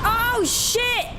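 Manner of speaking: high, sudden shocked cry; startled yelp. Real, not acted. Streamer to chat as an enemy jumps her, startled cry. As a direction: caught off guard, yelp it out.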